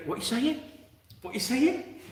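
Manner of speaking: high-pitched, rising puzzled question. Retold or imitated. Mate can't understand the Irish friend, high puzzled asking. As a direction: imitate someone baffled, voice jumping right up.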